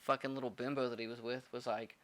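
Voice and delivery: ordinary talk, nothing marked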